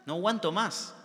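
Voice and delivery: a retold man who can't wait for heaven; eager longing. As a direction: let the longing burst out.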